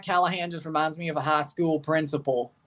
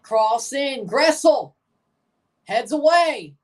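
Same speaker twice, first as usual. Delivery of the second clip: excited play-by-play; 6 semitones higher, 4 dB louder, slower than usual. Real, not acted. Live call of corner.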